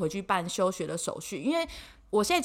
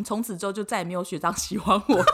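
usual speaking voice

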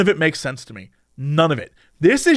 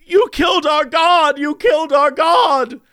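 high, wailing accusation, lightly acted; a husband's dream wife, crying over their god, mock wail; 8 semitones higher than usual. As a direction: play the sobbing wife accusing him, hammed up. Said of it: "she looks up at me and goes".

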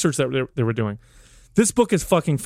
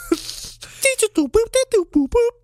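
high laugh. Real, not acted. Laughs at robot voice.

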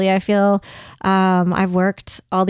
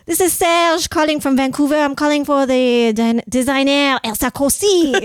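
imitation Frenchman making a business call; hammed-up French caller, pitched up; French accent; 5 semitones higher than usual. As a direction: put on a playful French accent. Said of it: "with a French accent".

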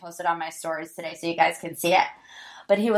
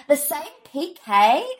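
higher, badly imitated British accent; horrible Dorit accent; 5 semitones higher than usual. Podcaster mimicking Dorit about P K, horrible British accent. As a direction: put on a bad British accent. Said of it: "That was a horrible Dorit accent".

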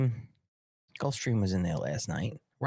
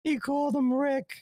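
excited disbelief. Real, not acted. Disbelief at the video's name gaffe.

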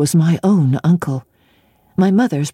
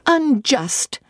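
higher, a touch of indignant protest; indignant outcry; 8 semitones higher, much slower than usual. A narrator's reading. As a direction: cry out against the injustice, held in. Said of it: "said my reason".

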